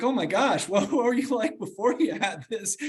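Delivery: high-pitched, laughing disbelief; retold reaction, tickled and incredulous; amused astonishment. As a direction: react with a giggly gasp of amazement. Amused reaction to upbeat friend.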